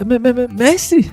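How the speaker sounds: stammering fright. Acted. Disciple caught by her master.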